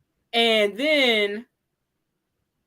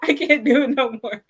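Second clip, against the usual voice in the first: laughing plea; 5 semitones higher than usual. Retold or imitated. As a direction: plead while breaking into laughs. Mock pleading while laughing.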